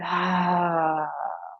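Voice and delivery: long open exhale sigh; audible sigh. Shown as a demonstration. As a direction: let the breath out with a sound. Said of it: "let that breath go with a sigh".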